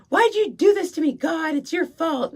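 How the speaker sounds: high, loud whine at God; whiny blaming child. Retold or imitated. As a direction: complain it like a spoiled kid.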